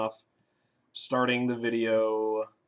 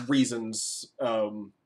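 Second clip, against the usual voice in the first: ordinary talk, nothing marked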